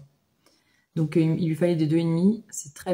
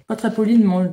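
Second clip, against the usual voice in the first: usual speaking voice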